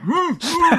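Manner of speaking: high-pitched muffled "hmm, hmm"; muffled captive hum. Pretend. Host pretending Dan is in the back, muffled high whimper. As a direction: hum like someone shut in a trunk. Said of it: "I can hear Dan in the back".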